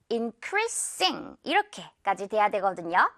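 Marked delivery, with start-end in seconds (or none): 0.1-1.4 increasing said very slowly with a clear s before ing; S sound kept. Shown as a demonstration. Teacher showing the ing form slowly, s kept. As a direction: stretch increasing, keeping the hissed s. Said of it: "아이엔지 처리가 된다면".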